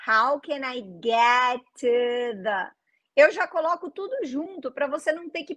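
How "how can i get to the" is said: slow, careful model phrase for students to copy; slow repeat-after-me. Shown as a demonstration. Teacher slowing down so students repeat with her. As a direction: say it slowly so the class can follow. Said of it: "faz comigo".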